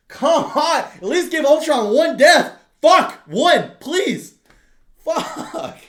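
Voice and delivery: loud, high outraged yelling; frustrated shout. Retold or imitated. Fan yelling at the movie about the villain's death. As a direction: yell it at the screen in disbelief. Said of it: "and I'm like".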